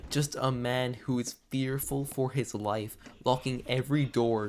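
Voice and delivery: usual speaking voice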